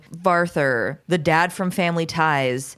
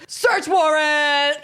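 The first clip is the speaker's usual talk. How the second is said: high, drawn-out mock shout; hammed-up cop's yell, pitched up; 9 semitones higher, 3 dB louder, slower than usual. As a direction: yell it theatrically, stretching it out. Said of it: "and just yells".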